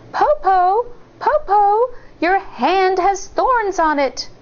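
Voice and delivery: put-on high worried little girl; high child voice; child's voice. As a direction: ask like an uneasy child. Child questions wolf in bed.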